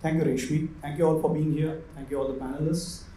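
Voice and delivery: ordinary talk, nothing marked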